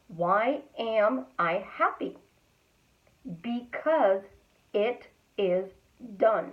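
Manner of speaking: "Why am I happy? Because it is done" in slow, separate pieces; long pauses around "it is" before "done"; slowed, fully pronounced. Shown as a demonstration. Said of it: "slowing down your words and actually pronouncing".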